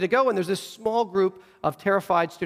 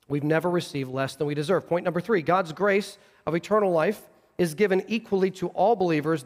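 ordinary talk, nothing marked

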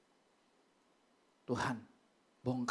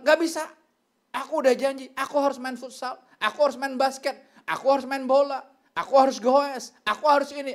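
high, loud, insistent protest; defiant child; 7 semitones higher, 8 dB louder than usual. Retold or imitated. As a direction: act out a child piling up excuses. Child refuses mother, listing hobbies.

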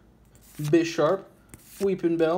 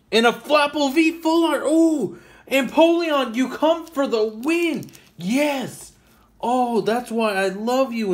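excited squeal; 13 semitones higher, 7 dB louder, slower than usual. Real, not acted. Pulls a full art card.

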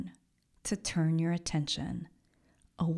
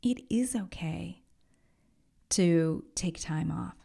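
gentle whisper. Pretend. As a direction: whisper it kindly, like comforting a tired friend. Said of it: "it's the voice that says".

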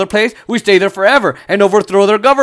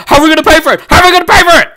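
mock politician; 7 semitones higher, 6 dB louder, much faster than usual. Mocking politicians' objection.

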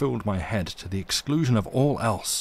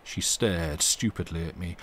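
ordinary delivery, nothing marked

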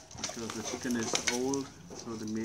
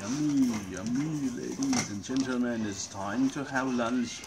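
mock announcer; 4 dB louder than usual. Host's playful cooking announcement.

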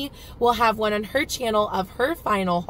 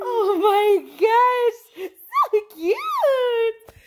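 gushing delight; 11 semitones higher, 5 dB louder, much slower than usual. Real, not acted. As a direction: melt over how cute he is. Mother delighted by toddler.

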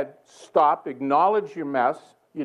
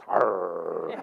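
low drawn-out growl; 9 semitones lower than usual. Retold or imitated. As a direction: growl at him, low and long. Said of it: "I think I growled".

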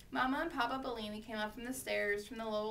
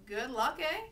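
doubting echo, rising; dry disbelief; 4 dB louder, slower than usual. A narrator's reading. Mother doubts her son's claim, dry disbelief. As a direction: throw it back as a sceptical question. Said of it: "sounding very dry when she didn't believe".

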